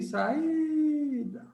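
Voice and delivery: SAÍDA with a high, sustained I; held vowel. Shown as a demonstration. Teacher repeating SAÍDA with the I held high, held vowel. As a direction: stretch the I in SAÍDA like a distant call. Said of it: "Você segura no i".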